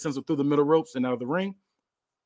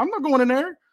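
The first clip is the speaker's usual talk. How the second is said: high cartoonish refusal; scared refusal; 12 semitones higher than usual. Tagged wrestler refusing his partner, high scared voice. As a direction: squeak it, refusing to go in. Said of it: "He's like".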